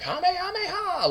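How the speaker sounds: very high, held yell of Kamehameha; high cartoon battle cry; Goku's Kamehameha. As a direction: stretch the attack name out high like Goku. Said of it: "Goku says".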